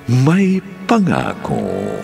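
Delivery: ordinary delivery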